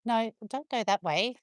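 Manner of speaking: usual speaking voice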